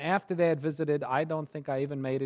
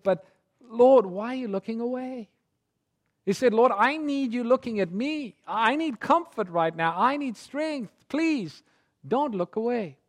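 high, loud, pleading cry to the Lord; a man in hospital crying out to Jesus, high and loud; pleading prayer; 9 semitones higher, 6 dB louder than usual. Retold or imitated. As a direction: beg him not to look away, full voice. Grandpa's desperate prayer retold.

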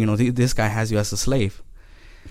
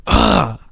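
high, exasperated retold groan; frustrated groan; 8 semitones higher, 3 dB louder than usual. Driver reluctantly turning the car back, frustrated groan.

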